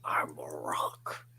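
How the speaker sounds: low, slow whispered rasp; grinding stone gravel voice. Acted. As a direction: grind it out like a boulder. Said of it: "It'd be a gravelly voice".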